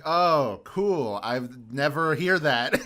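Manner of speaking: loud, high, mock-amazed reaction; mock surprise. Hearing the mom's Yiddish accent on TV, loud mock surprise. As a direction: play up the delight at hearing a rare accent. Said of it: "I'm like".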